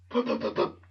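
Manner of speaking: quick puffing stuttered breaths; mock hitching breaths before a cry; imitated pre-cry breaths. Kid trying not to cry, puffing pre-cry breaths. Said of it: "those pre-cry breaths".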